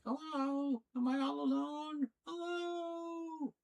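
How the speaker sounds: very high, plaintive cat voice; cat calling out. Retold or imitated. Streamer voicing her cat calling out to find them, high and plaintive. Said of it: "calling out to us, like".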